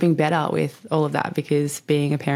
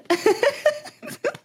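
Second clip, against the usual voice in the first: delighted high laugh; real laugh of amazement. Real, not acted. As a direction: let a high laugh burst out. Laughing at incredible news.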